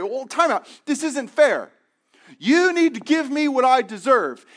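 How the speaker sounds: high, loud mock outrage; indignant protest. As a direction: demand what you deserve. Listeners protesting unfairness.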